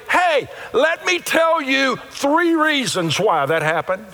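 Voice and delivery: high, slow, eager preacher voice; preacher giving a lesson. Pretend. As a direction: play the keen preacher laying out points. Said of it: "What you might do is say".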